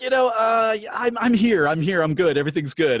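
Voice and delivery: put-on high, loud British accent, bluffing. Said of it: "I spoke with the British accent".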